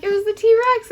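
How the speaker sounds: high, loud, hammed-up excuse; sheepish intern. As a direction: blame the T-Rex like a caught kid. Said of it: "he was like".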